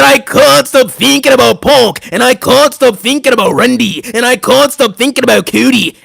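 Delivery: hammed-up Scottish accent; Drew McIntyre. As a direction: punch each phrase in a thick Scottish voice. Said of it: "doing the Drew McIntyre impression".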